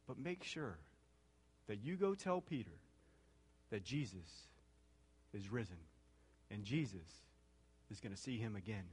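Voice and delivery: soft, gentle voice of Jesus reassuring about Peter; tender. Acted. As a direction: hush it down like a loving promise. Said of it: "Don't you love the tenderness here?".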